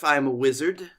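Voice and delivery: ordinary talk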